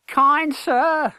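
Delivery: put-on high, fawning boy's voice; young beggar. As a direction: wheedle the stranger. Hugo's voice.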